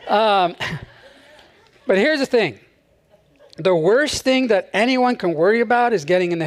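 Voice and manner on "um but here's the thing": ordinary talk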